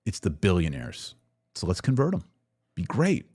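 normal talking voice

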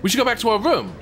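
high, eager burst of an idea; sudden excited realisation. Real, not acted.

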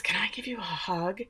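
hushed, breathy asking; whispered request. Retold or imitated. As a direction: imitate a creepy whispered plea. Creepy whispered request, retold.

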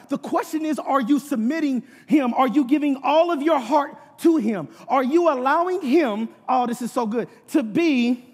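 emphatic, driving sermon delivery; preaching. Real, not acted. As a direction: press each point home to the congregation. Preaches at full force.

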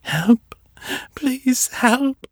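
high, rising call for help; desperate cry for help. Acted. Seffi calling the approaching minds, desperate cry. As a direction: cry out with every ounce left.

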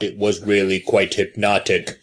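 low, measured recital; Picard. Retold or imitated. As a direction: deliver it as a droning, deliberate speech. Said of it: "Like, Picard's, like, trying to make a point".

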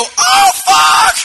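very high, loud shocked shout; shocked yell. Retold or imitated. Player seeing his soldier fall, shocked shout. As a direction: yell in horror at the screen. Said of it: "I go".

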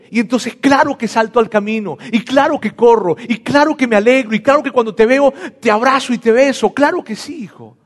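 high, loud, eager and overflowing; joyful father. Acted. Father running to embrace his son, loud and joyful. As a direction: pour out each claro with delight.

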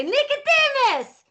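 high, drawn-out call; long high-pitched holler of a name; shouted name. Acted. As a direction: shout for the cat in character. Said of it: "I just shout it".

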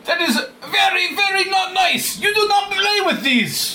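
put-on high, slow, shouting Russian accent. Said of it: "Good Russian accent".

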